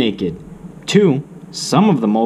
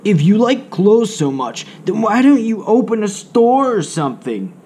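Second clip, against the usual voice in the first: higher, mocking character voice; sarcastic; 10 semitones higher than usual. A mole rat mocking Wilbur's love of clothes, sarcastic. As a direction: sneer the suggestion. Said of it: "Naked mole rats can be very sarcastic".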